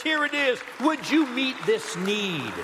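preacher's acted plea of faith; speaking up boldly. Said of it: "faith speaks up and says".

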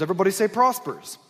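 ordinary talk

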